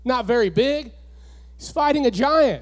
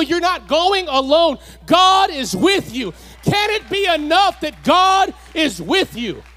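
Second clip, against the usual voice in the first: louder, higher preaching with a shout; preaching at full force; 4 semitones higher, 4 dB louder than usual. Acted. Preacher assuring the congregation they're not alone, at full force. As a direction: drive each line home, full revival force. Preacher driving home God is with you.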